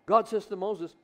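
usual speaking voice

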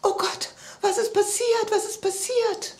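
very high-pitched panicked cry; loud scream. A narrator's reading. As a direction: shriek in alarm as the little character. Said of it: "schrie laut auf". (laut und panisch aufschreien)

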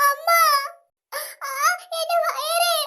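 sobbing, gasping moans; crying in pain.

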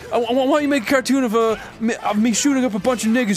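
high, fast rapper voice. Retold or imitated. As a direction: imitate him hyped up. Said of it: "he was like".

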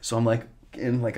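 ordinary talk

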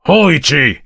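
loud, high savage shout; fierce, savage summons; savage call. A narrator's reading. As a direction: bellow the name savagely.

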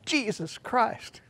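high, slow mock curse; mock locker room swear. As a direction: drag it out like a jock swearing. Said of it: "swear in the locker room".

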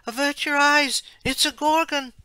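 high, loud warning cry; shout. Acted. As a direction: yell a panicked warning. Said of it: "turn her head and shout".

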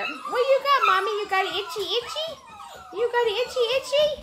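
high doting questions to a pet; playful pet talk. Real, not acted. Baby talk to the mother dog.

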